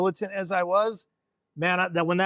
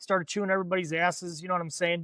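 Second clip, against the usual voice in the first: usual speaking voice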